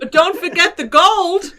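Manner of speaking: slow amateur brogue near laughter; Irish accent. Acted. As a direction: remind them in a drawn-out Irish accent. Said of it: "do the accent that I can't do".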